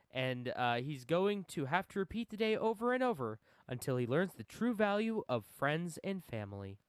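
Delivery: upbeat game blurb read; promo read. Acted. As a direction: sell the game like an ad. Reads out game's promo blurb.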